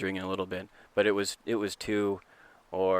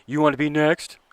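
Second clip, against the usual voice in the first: louder, higher challenge to the coach; mock umpire threat; 7 semitones higher, 8 dB louder than usual. Umpire daring the assistant coach to be next, loud and challenging. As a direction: bark it as a dare.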